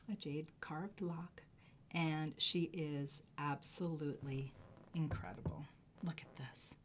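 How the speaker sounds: hushed, breathy whisper of awe; awed whisper to the camera. Real, not acted. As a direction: whisper it like a secret treasure. Admiring the carved lock.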